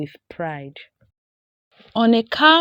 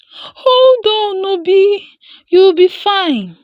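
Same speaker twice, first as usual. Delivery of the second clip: high, loud, urgent comforting; calm the bitten brother through fear; trembling reassurance; 13 semitones higher, 9 dB louder, slower than usual. A narrator's reading. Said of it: "she assured him with trembling voice".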